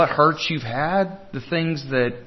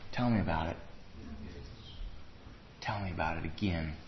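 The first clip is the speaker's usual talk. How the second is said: much lower and softer; gentle invitation; 10 semitones lower, 15 dB quieter, faster than usual. Acted. The Lord asks about someone's pain, low gentle voice. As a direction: draw out a confidence, low and quiet. Preacher voices the Lord's compassionate question.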